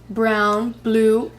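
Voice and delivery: ordinary delivery, nothing marked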